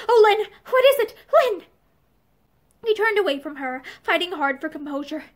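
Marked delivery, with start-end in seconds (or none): 0.1-1.7 high, loud, anxious cry; crying out in distress. A narrator's reading. Woman feels his arms fall away, distressed cry. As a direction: call to him in alarm, pitched up. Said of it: "she cried out in distress".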